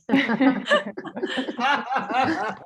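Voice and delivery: long, rolling amused laugh; hearty laugh. Real, not acted. Panelist laughing after teasing the questioner, hearty laugh.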